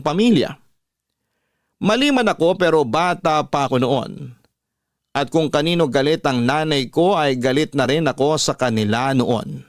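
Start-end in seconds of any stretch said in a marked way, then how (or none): none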